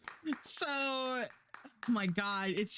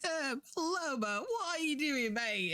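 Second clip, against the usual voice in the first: higher, hammy Aussie penguin voice doing Australian; mock Australian accent; 7 semitones higher than usual. Space penguins greeting Lobo in a bad Australian accent. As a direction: put on a bad Australian accent like an American faking it. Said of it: "Americans doing an accent".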